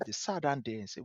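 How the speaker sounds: ordinary talk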